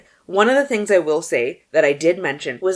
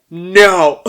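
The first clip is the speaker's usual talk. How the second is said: retold loud no; 13 dB louder than usual. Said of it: "I was like".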